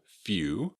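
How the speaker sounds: "few" isolated as the opening syllable of future; "few" held up by itself as a syllable model; stressed first syllable. Shown as a demonstration. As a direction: say "few" alone as a demonstrated syllable. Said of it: "the stress is on the first syllable".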